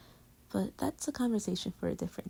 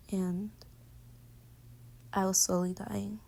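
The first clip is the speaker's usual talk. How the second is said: vulnerable whisper; 6 dB quieter than usual. Real, not acted. Serious vulnerable question in bed.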